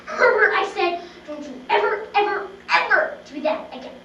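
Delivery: loud scolding. Acted. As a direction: scold the bear with all your might. Final scolding of bear.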